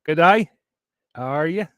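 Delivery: playful Australian greeting; Aussie accent. Shown as a demonstration. Vlogger showing off the only phrase known, Aussie accent. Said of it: "That's all I c-, can say with an Aussie accent".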